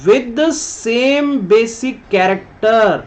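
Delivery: reads the English line very slowly, word by word; teacher reading the slide slowly to the class; slow reading aloud. Shown as a demonstration. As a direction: dictate it so students can follow. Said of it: "दोबारा से पढ़ लो इसको".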